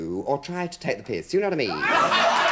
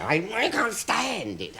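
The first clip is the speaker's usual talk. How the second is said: high, stretched-out mock anguish; hammed-up dread; 10 semitones higher, slower than usual. Comic confessing phobia of footsteps, hammed-up dread. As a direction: play up the unbearable torment.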